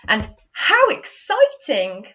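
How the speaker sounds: high, loud, slow and thrilled; excited exclamation. Shown as a demonstration. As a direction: gush over it with delight. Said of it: "did you see Miss Andrews put expression on".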